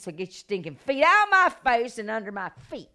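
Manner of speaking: loud burst of defiance; rebuking the devil. Retold or imitated.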